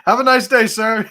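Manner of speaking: nervously polite. Retold or imitated. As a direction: back away politely from a man with a gun. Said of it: "I'm like".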